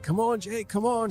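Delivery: high, urgent call for the dog. Retold or imitated. Man calling his dog in the dark woods, urgent high call.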